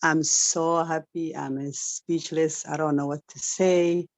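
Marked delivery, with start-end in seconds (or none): none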